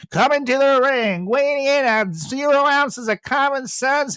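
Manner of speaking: high, loud, drawn-out ring intro; wrestling ring announcer. Pretend. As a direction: boom it like a WWE announcer. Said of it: "the cringiest W W E catchphrase".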